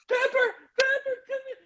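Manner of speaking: squeaky, very high sobbed call; mock crying. Crying dad calls to his kid, imitated high wail. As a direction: call the child through hammed-up tears. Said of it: "my kids can hear me crying".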